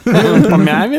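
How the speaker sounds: high, laughing Odessa question; odessa russian accent. Pretend. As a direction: echo it in an Odessa lilt. Joking along in the accent. (одесский акцент, со смехом)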